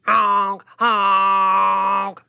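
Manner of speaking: loud, silly boat horn honks; silly clown. Pretend. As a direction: honk like a little foghorn. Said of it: "It's like".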